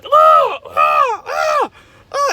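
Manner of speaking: loud, high startled cries; shocked shouting. Retold or imitated. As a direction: yelp at the shock. Nephew shocked at the sight.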